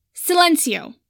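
high, loud shouted incantation; yelled spell. A narrator's reading. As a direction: yell the spell to silence him. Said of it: "Pansy yelled".

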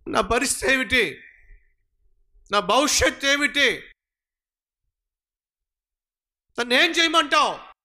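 desperate cry. Retold or imitated. David desperately asks God what to do, pleading. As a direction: climb in pitch with each desperate question. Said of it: "దేవుని దగ్గర విచారణ చేశాడట".

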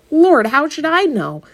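high, loud exasperation; exasperated outburst. Acted. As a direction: throw up your hands. Jess exasperated at Leslie.

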